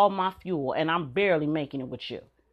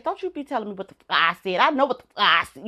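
high, louder, fast mock fury of an old-school mama; mama's angry retort; 4 semitones higher, 6 dB louder, faster than usual. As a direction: cut the kid off hard. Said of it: "the first thing she gonna say".

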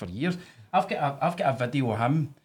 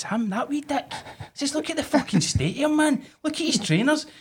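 mock scornful outrage. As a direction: sneer at the bully's trainers.

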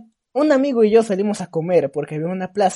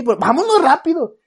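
higher, louder scared insistence; urgent plea; 7 semitones higher, 6 dB louder than usual. Retold or imitated. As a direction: push her to hurry up, frightened. Kid urging mom again.

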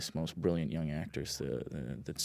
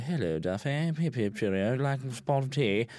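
higher, hammed-up British accent; 7 semitones higher, 3 dB louder than usual. Imitating the actor greeting the director on set, British accent. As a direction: put on a jolly mock-British voice, pitched up. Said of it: "he's actually British, too, which is weird".

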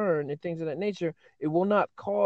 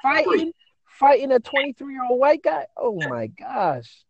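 shocked disbelief; 6 semitones higher, 5 dB louder than usual. Real, not acted. Host shocked at fight story.

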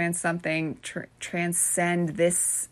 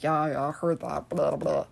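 brushing it off in a low voice; low, bored brush-off; dismissive; 4 semitones lower than usual. Acted. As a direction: rattle it off like old news. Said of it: "my instinct is like".

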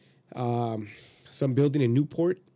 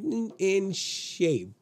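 "in, in shape" drawn out slowly, higher; emphatic insistence; 8 semitones higher, much slower than usual. Real, not acted. Insisting to friend on his shape.

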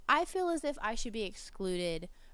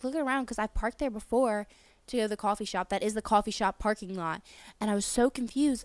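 normal talking voice

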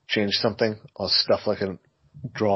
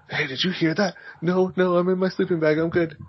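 high mock camper voice; 7 semitones higher than usual. Camper voices in sleeping bag.

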